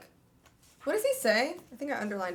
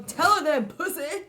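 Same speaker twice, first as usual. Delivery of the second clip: louder, higher jeer at the hero; mock taunt; 5 semitones higher, 5 dB louder than usual. To the book hero, a loud mocking jeer. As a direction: heckle him, half joking. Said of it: "I go".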